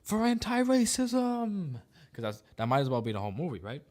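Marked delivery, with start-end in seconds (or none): 0.0-1.8 high, loud, slow mock war cry; hammed-up heroic charge; stretched-out parody yell; mock battle cry. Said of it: "this time he was saying".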